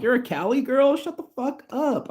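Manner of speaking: high, squealing shout of disbelief; shocked disbelief. Retold or imitated. Telling Joy he can't believe she's from Cali, high squealing disbelief. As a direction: squeal it like you can't believe your luck. Excited disbelief at meeting a Californian.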